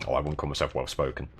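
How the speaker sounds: ordinary talk, nothing marked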